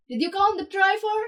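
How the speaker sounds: calling out. A narrator's reading. Bank robber calls to leader.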